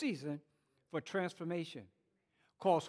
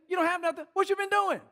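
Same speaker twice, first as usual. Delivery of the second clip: higher, incredulous "What you been doing?"; can't believe it, ask him back; incredulous retort; 7 semitones higher, faster than usual. Acted. Ant demanding an answer from the grasshopper, incredulous.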